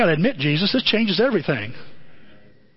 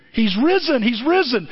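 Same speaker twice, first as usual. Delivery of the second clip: excited announcement; 6 semitones higher than usual. Acted. Mary running to tell disciples.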